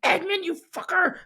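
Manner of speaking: high-pitched mock British outrage. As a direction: put on a shrill British accent, cursing the traitor. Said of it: "You do that in a British voice and the high pitch".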